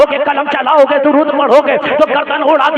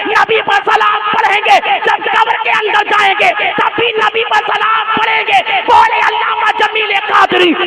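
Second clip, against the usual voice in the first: fiery preaching; 7 semitones higher than usual. Acted. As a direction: drive to the climax of the sermon. Preaching at full force. (पूरे जोश में तक़रीर)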